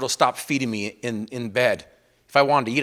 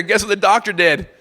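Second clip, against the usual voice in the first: ordinary talk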